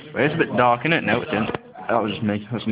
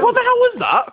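very high mocking squeak; squeaky high. Mocking the horse impression.

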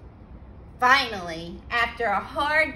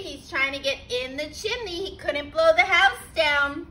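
plain reading voice

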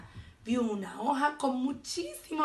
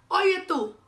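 high, loud challenge; brash call; 8 semitones higher, 7 dB louder than usual. A narrator's reading. Grumpy ladybug hailing a hornet, loud and brash. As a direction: hail a stranger rudely.

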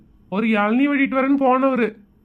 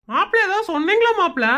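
a touch of nosy in-law; 6 semitones higher than usual. Acted. Voices father-in-law asking groom.

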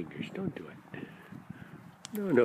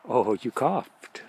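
normal talking voice

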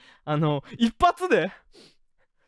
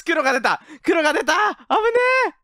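excited; 7 semitones higher, 4 dB louder than usual. Real, not acted. Excited at game result.

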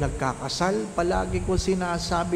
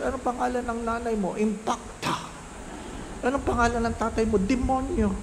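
put-on teacher and child; 6 semitones higher, faster than usual. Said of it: "alam niyo ang kwento".

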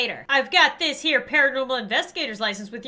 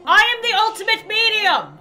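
proud boast; 8 semitones higher, 8 dB louder than usual. Real, not acted. Bragging after maxing medium skill.

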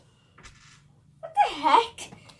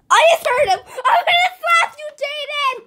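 playful yell; 9 semitones higher than usual. Real, not acted. Found brother's camera.

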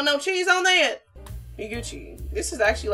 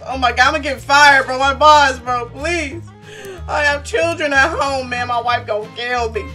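mock panicked pleading; 5 semitones higher, 7 dB louder than usual. Fears getting fired, mock pleading.

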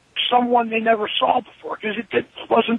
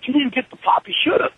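ordinary talk

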